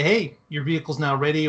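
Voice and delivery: ordinary delivery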